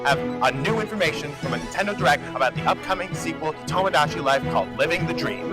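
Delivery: news anchor intro. Acted. Reporter opening the Bonus Level News package, anchor voice.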